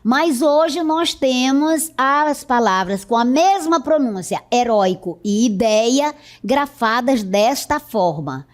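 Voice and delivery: ordinary delivery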